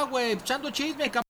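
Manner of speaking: hushed talk. Acted. Caught friend talks low through the door, hushed. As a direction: keep your voice down so he won't hear.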